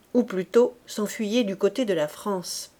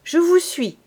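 put-on a much higher, keen young voice; eager youth; 9 semitones higher, 7 dB louder than usual. Young Fabrice to the corporal, eager and high. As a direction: jump at the chance to fight.

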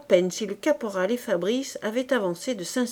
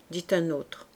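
usual speaking voice

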